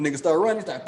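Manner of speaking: ordinary delivery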